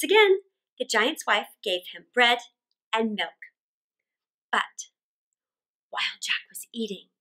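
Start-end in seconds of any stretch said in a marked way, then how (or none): 4.5-7.1 soft, hushed whisper; suspenseful whisper. A narrator's reading. Suspense before giant returns.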